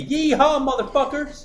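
high, loud Southern yeehaw holler; fake Southern accent. Host's imagined first day on Louisiana radio, fake Southern whoop. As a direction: whoop it in a hammed-up Southern accent, breaking into laughter. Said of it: "I'm gonna be talking like that".

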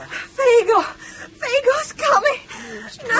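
high, tearful frightened cry; panicked sobbing shout; crying out in fear. Acted. Nightmare, oxygen-starved child cries out.

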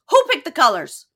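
high, loud accusing question; angry demand. Retold or imitated. Girlfriend confronting boyfriend about colors, angry. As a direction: fire it off as a mock interrogation.

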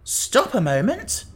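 high, drawn-out interruption; exclaiming. A narrator's reading. Jack stopping the others, exclaiming. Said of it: "exclaimed Jack".

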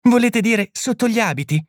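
lightly voiced puzzled young woman. A narrator's reading. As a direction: ask it as the young lady, puzzled. Miss Macnab's line voiced.

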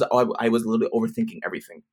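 usual speaking voice

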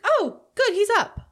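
breezy imitation, pitched very high; imitated casual remark. Cohost imitating Bev casually walking in, breezy and high-pitched. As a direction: mimic her strolling in, high-voiced and unbothered. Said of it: "She just kinda casually comes in".

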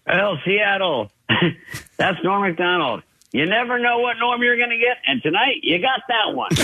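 lightly voiced Schneider teasing the crowd; imitating Schneider. Friend retelling Schneider's jab at Norm, mock announcer.

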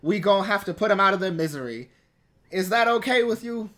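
ordinary talk

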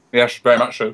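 talking through a mouthful; words round a bite of food; mouth full. Real, not acted. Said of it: "Sorry, I'm eating a little bit of food".

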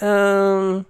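high, loud drawn-out hesitation; mock a hesitant wife with a high hum; imitating wife. Wife pondering Tex-Mex, high hesitant voice. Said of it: "she's like".

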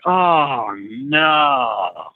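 long drawn-out retold groan; slow-motion dismay. Trainer recalling watching coolant leak, drawn-out dismay. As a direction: stretch each word as if in slow motion.

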